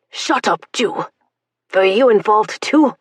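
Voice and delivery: loud harsh interrogation; harsh soldier. Acted. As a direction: snarl at her. Soldier berating prisoner.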